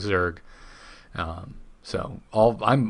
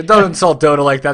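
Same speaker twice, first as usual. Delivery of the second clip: loud, high, playful indignation; hammed-up protest, half joking; mock outraged shout; 6 semitones higher, 12 dB louder than usual. Mock outrage defending Dota.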